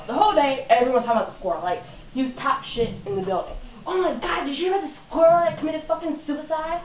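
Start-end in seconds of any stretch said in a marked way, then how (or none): 3.9-6.8 high-pitched, breaking-news gossip; mock-scandalized, pitched up; excited gossip. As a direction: imitate a schoolmate spreading shocking news. Imitates gossiping schoolmates.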